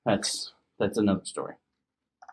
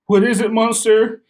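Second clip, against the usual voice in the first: mom voice; 11 semitones higher, 7 dB louder than usual. Acted. Mommy voiced.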